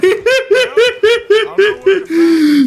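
high, loud burst of helpless laughter; cracking up at the chaos; wild laughter. Real, not acted. Horse drags the guy away. (carcajada aguda y fuerte)

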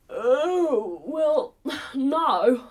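words stretched through a yawn; yawning crocodile. A narrator's reading. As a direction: yawn while answering, like a lazy crocodile. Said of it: "The crocodile yawns again and says".

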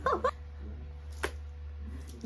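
high breathless wheeze of laughter; mom's wheezing laugh. Retold or imitated. Mom cracking up over the phone, breathless wheezing laugh. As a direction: silent shaking laugh. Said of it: "all I hear is".

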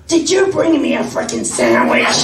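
loud squawky demand, laughing; demanding Marge-like lady. Retold or imitated. Lady yells at the guy dragging suitcases, loud squawky demand. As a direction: bark it in the Marge voice. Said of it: "related, I think, to Marge Simpson".

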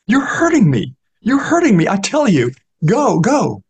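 the beggar begs Newman to go away, hurting; pained pleading beggar. Acted. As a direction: plead in pain for him to leave. Beggar in pain begging Newman go.

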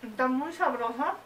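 talking with a mouthful of bread; words through a bite, still chewing; mouth full. Real, not acted. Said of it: "Perdón por hablar con la boca llena".

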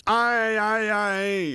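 high, loud exasperated wail; annoyed groan. A narrator's reading. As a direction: groan in irritation. Catcher groaning at noise. (geërgerd gekreun, hoog en luid)

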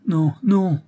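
high, loud desperate no; crying out. A narrator's reading. Angélique in her beloved's arms crying out. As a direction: cry it out, clinging to him. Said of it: "s'écria plusieurs fois Angélique".

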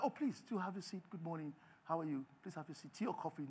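soft, low, courteous offer; quietly gracious acted host; formal polite host. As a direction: murmur a reserved, businesslike welcome. Formal lender offers a seat.

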